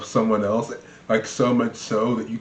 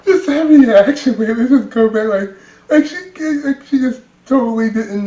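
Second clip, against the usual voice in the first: laughing; 13 semitones higher, 9 dB louder than usual. Real, not acted. Laughing at the clip.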